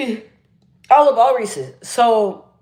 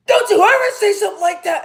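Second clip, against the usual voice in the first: much higher, projected brotherly scolding; chest-voice scolding; 11 semitones higher than usual. Retold or imitated. Big brother scolding someone during an argument, projected from the chest. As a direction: boom it out like an angry big brother. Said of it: "when you project from the chest".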